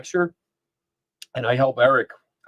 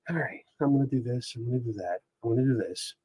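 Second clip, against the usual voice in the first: much softer, casual off-mic talk; off-air voice; 11 dB quieter, faster than usual. Shown as a demonstration. Streamer showing how they talk before recording, quiet. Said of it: "I can sit here and go".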